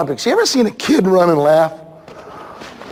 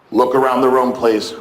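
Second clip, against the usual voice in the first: usual speaking voice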